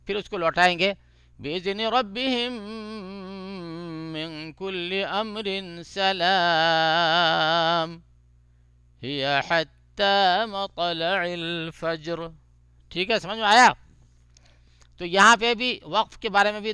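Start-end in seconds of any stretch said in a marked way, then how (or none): 1.4-12.2 slow, drawn-out melodic tajweed chant; Quran recitation. Shown as a demonstration. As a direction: chant each phrase slowly with long vowels.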